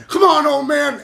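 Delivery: goading shout, retold; retold taunt. Retelling his taunt in the ring.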